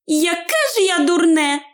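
high-pitched self-reproach; Bilbo chiding himself. A narrator's reading. Invisible hobbit realises his folly, high self-chiding. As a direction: scold yourself in a high hobbit voice. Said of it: "мовив сам до себе".

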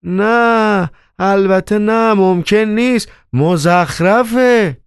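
emphatic no, drawn out at the end; firm outcry. A narrator's reading. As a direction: cry out flatly refusing it. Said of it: "با قاطعیت فریاد کشید".

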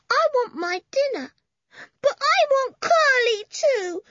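put-on high, simple toddler voice; little boy voice. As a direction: speak like a small child. Benny's child voice.

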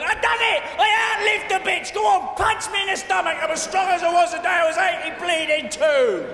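high, loud cackling brag; triumphant shout. Retold or imitated. Old Queen Mum crowing over her rival at the funeral, shouting high. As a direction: yell it like an old woman who just won.

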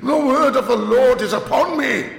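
very high, loud prophetic cry; raving curate. Acted. Curate proclaiming God's word, loud high shout. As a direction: cry out possessed by the Lord. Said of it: "shouted the curate at the top of his voice".

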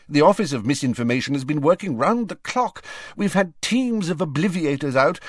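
high-pitched, hurried official report; harassed Fudge. A narrator's reading. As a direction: pour out the troubles, sinking to a hushed finish.

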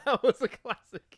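high, loud, slow words through laughter; helplessly cracking up while speaking; real laughter. Real, not acted. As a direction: stretch the words out as you laugh. Cracking up over remembered film.

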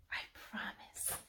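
hushed, voiceless promise; whispered. A narrator's reading. Rooney promises to care for the bell, whispered. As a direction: whisper it very softly. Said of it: "Rooney whispered".

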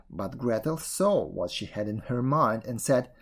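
plain reading voice

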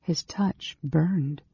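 ordinary delivery

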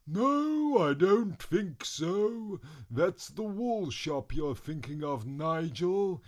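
loud, slow, high put-on voice; booming wizard. Second wizard corrects Nigel, slow booming voice. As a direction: draw it out grandly, correcting.

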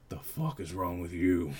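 a hint of a grumpy old man, irritated; irritated old farmer. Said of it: "irritated look across his face".

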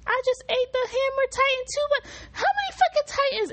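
high put-on cocky voice; mock Eren voice. Fan mocking Eren in a high put-on voice. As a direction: squeak it out as a smug Eren. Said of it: "He said".

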